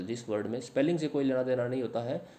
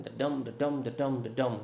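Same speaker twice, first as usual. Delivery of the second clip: "the dum" as da-DUM, weak then strong; iambic beat; much slower than usual. Shown as a demonstration. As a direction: chant "the dum" evenly, landing on "dum". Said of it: "तो इसको हम ऐसे बोलते हैं". ("the dum" में "dum" पर ज़ोर, ताल में)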